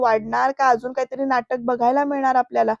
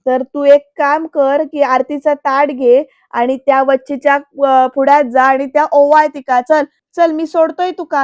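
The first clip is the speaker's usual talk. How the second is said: loud angry; 6 dB louder than usual. Retold or imitated. Varad sarcastically yelling at his aunt, getting louder. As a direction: hurl it at her in mounting rage. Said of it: "तो खूप जोरात बोलतोय".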